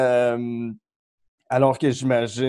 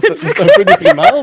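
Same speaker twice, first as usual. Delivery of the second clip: laughing; 11 dB louder than usual. Real, not acted. Laughing through wry closing line.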